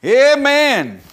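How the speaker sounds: loud, high amen falling; shouted amen. Real, not acted. Preacher rousing the congregation, loud high amen.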